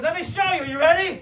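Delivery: high, loud build-up; rally him before the demonstration; acted loud coaching; hyped-up instructor.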